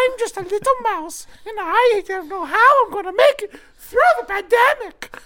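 tiny squeaky mouse voice; very high, trembling little mouse; frightened prey animal. Acted.

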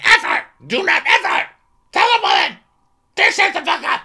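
hammed-up screaming; mock shout. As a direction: scream the rule at her. [name] yelling in recap.

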